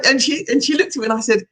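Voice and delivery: usual speaking voice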